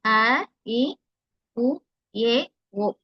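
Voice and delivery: அ, இ, உ each cut short as single beats; short vowels. Shown as a demonstration. Teacher giving examples of kuril, short vowels. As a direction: say அ and இ briefly, without stretching. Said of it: "ஷார்ட்டா சொல்றோம் இல்லையா?".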